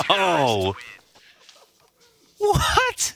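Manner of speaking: high disbelieving yelp; shocked. Real, not acted. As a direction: react in total disbelief. Co-caster shocked reaction.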